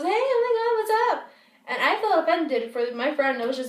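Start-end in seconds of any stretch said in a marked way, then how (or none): 0.0-1.3 high, loud, fake-friendly squeal of hello; mock gushing greeting. Mimicking a girl suddenly greeting another guy, mock gushing. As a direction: imitate an over-delighted greeting, pitched way up. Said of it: "She laughs, smiles, and just goes".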